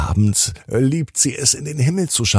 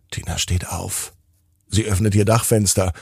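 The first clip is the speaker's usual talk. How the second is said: ordinary delivery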